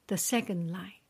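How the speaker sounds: ordinary delivery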